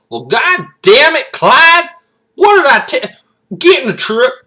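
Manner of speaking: high, shouted Southern scolding; exasperated Southern kid. Acted. Kid scolding his buddy after the shotgun fired, exasperated Southern yell. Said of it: "like, your Southern".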